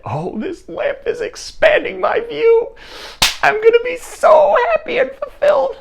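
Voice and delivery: very high-pitched, squealing joy; giddy elation. Acted. King thrilled by the lamp's offers, giddy. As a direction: gush in a tiny, sky-high voice. King elated by growing wishes.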